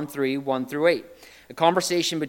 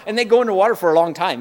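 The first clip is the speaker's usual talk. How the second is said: ordinary delivery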